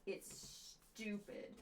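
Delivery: soft, slow, breathy put-down; cold critic. Retold or imitated. Mean viewer dismissing the droid, cold. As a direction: sneer it low and slow. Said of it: "you'd be like".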